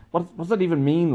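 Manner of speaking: acting out baffled exasperation; baffled protest. Replying to Bella about the Christmas lyric, incredulous.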